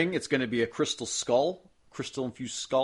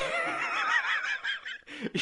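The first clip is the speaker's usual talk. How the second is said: high-pitched laughing fit; cracking up; 3 dB quieter than usual. Real, not acted. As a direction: dissolve into real laughter. Cracks up at co-host's verdict.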